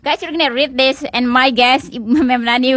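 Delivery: ordinary talk, nothing marked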